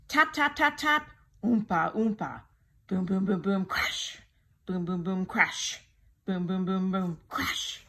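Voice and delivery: tap, oompa and boom sound words; musical noise effects. A narrator's reading. Narrator sounding the tiger's tap shoes and drums, playful. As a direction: play the band with your voice.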